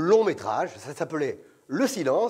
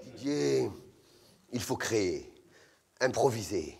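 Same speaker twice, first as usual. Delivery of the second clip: lower, breathy arty director; casting director voice; 8 semitones lower, 6 dB quieter, slower than usual. Retold or imitated. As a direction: intone like a pretentious artist. (voix grave de directeur artistique inspiré)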